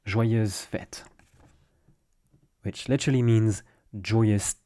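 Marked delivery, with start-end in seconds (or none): 0.1-1.0 Joyeuses Fêtes said slowly, zh-wah-yuhz fet; model pronunciation. Shown as a demonstration. Teacher models Joyeuses Fêtes for learners, clear and careful. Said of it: "how to say Happy Holidays is".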